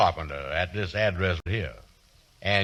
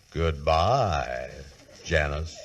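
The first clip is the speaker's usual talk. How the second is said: lovesick, lower, drawn-out goodbye; dying calf; 6 semitones lower, 3 dB quieter, much slower than usual. Acted. [name] to Janice on the phone, lovesick mooning goodbye. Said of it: "You sound like a dying calf there".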